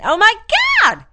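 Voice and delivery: high, swooping exclamation; hammed-up gush with big pitch swings; pitch and inflection. Shown as a demonstration. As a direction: demonstrate an over-inflected outburst. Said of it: "pitch and inflection".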